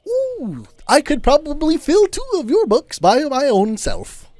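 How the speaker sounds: high, showy ooh and brag; high-pitched bragging old man; boastful high old traveler. Acted. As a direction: show off your adventures. Volo boasting about his travels.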